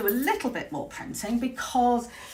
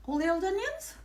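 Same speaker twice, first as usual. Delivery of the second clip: puzzled echo; 9 semitones higher, 4 dB quieter than usual. Real, not acted. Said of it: "I think I've said that because".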